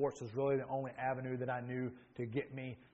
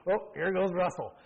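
exasperated scoff; 5 semitones higher, 7 dB louder than usual. Retold or imitated. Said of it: "and I'd be like".

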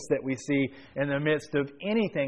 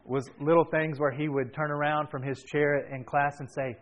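ordinary talk

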